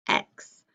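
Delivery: just the ks sound. Shown as a demonstration. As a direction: say "X" as a voiceless ks. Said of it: "say the sound it makes".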